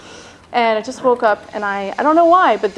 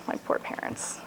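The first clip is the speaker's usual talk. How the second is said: whispered regret; 15 dB quieter than usual. Real, not acted. Repeated in a whisper.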